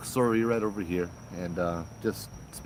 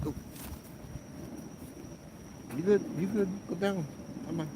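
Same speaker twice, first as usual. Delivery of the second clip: much higher, coaxing the dog; high dog-talk; 11 semitones higher, 6 dB quieter than usual. Real, not acted. Owner checking on his dog in a high voice. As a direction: coax the dog gently up high.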